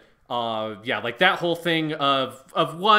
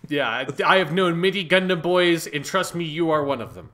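mock captain speech; 4 semitones higher, 7 dB louder than usual. Voicing Bright's pretend speech.

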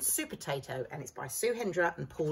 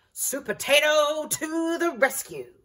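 slow, loud, higher heroic call; heroic announcement; 8 semitones higher, 8 dB louder, slower than usual. A narrator's reading. Narrator announcing the hero to the rescue. Hero's entrance.

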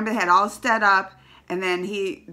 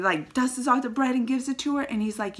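ordinary talk

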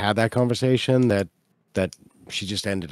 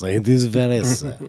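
put-on gravelly French accent. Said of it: "Nook has kind of a gravelly voice".

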